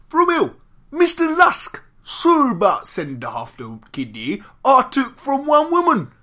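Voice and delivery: loud, slow, high-pitched Cockney caricature; Cockney accent. Acted. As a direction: drag out each word in garbled Cockney. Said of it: "I like your Cockney accent".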